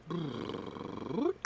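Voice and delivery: soft lip trill and squeaky yowls; vocal warm-up noises. Shown as a demonstration. Host warming up before the audition, vocal warm-up sounds. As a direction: run silly warm-up noises. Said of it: "do a little vocal warm-up".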